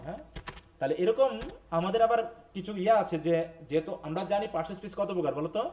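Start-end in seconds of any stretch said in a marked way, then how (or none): none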